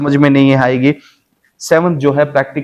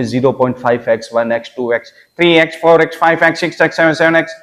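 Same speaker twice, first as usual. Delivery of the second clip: counting up, rising and getting louder; climbing from plain to loud and higher; speeding up; 4 semitones higher, 4 dB louder, faster than usual. Shown as a demonstration. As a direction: build the count faster and louder. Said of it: "जैसे जैसे आप pace बढ़ाते जाते हैं".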